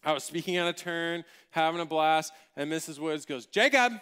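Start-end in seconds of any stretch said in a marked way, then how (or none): 3.5-3.9 loud, raised mock reprimand; teacher's sharp call. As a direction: call the pupil out across the class. Teacher calls out a talking pupil.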